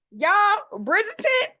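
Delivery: high, loud burst of excitement; excited outburst. Real, not acted. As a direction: blurt it out, thrilled. Sudden excited topic switch.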